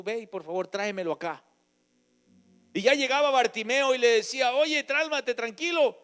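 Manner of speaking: loud, high fervent preaching, slowing on key phrases; preaching at full force. Acted. Preacher telling the congregation how Jesus healed the blind man, fervent. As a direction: proclaim the healing to the church. Preaching, voicing Bartimeo comforting others.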